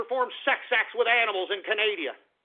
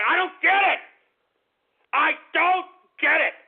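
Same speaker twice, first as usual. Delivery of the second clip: much higher and louder outburst; disgusted yell; 7 semitones higher, 5 dB louder than usual. Real, not acted. Host rants about lonely farmers, disgusted yell. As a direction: shout out your disbelief.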